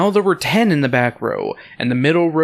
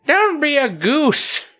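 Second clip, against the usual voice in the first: high, slow granny scolding; high-voiced old woman chiding; scolding grandmother; 13 semitones higher, slower than usual. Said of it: "she scolds you".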